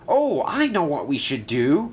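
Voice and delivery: high, eager exclamation; excited cry. A narrator's reading. Percy pitches an idea to the engines, crying out eagerly. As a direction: burst out with a bright idea. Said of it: "cried Percy".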